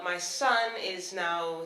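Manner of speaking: normal talking voice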